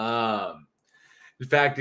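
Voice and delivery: normal talking voice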